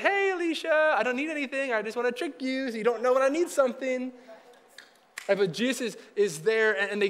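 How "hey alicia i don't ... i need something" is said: hammed-up high, loaded greeting; exaggerated "I need something" voice. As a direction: play up the high favor-asking voice to tease a coworker. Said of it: "every time you talk like that".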